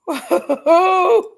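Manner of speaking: high, loud run of hoots; real overwrought whoop; agitated whooping. Real, not acted. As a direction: let off steam with a rising "whoo-hoo-hoo". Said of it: "Gotta get my mind in order".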